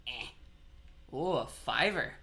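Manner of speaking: ordinary delivery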